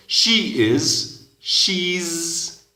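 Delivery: She is and she's said very high; high model forms. Shown as a demonstration. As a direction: sing out the contraction high. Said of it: "llega a ser". (she's, alto y claro)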